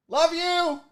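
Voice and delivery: high sing-out to a pet; affectionate call. Real, not acted. Host to cat after scolding, high affectionate call.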